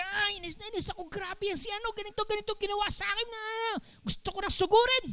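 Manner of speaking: imitating an angry person venting fast; mock furious rant. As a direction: rattle off the complaint, seething, quick and hushed. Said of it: "Galit na galit siya".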